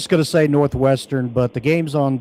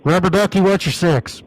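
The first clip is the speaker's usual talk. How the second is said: CB trucker talk; 5 semitones higher, 6 dB louder than usual. Pretend. CB trucker radio banter.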